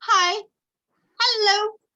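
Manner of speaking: bright high loud hello; high-pitched greeting. Shown as a demonstration. Teacher showing pupils a high-pitched hello. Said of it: "with your pitching".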